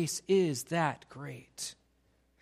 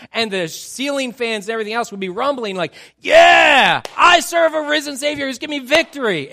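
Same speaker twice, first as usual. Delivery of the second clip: full-throated shout, high and loud; preacher mimicking a rowdy congregation, shouting praise; boisterous church shout; 5 semitones higher, 11 dB louder than usual. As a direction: shout it to rattle the ceiling fans. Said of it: "more loud and boisterous".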